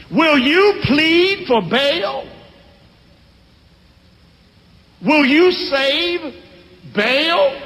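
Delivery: high, slow, drawn-out challenge to the crowd; defiant old father. Acted. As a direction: stretch every word to taunt them. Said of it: "Daddy talking crazy".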